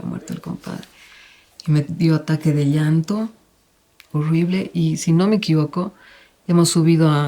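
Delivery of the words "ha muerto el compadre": the headline fades to a voiceless whisper; fading whisper. Retold or imitated. Repeating the headline until it fades into a whisper. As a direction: let "ha muerto el compadre" die away into a whisper.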